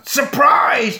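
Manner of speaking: loud, high mock yell; spring it out as a shouted ambush; Chucky's shout. Chucky ambushing Andy in the house, shouted. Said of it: "Chucky shouts".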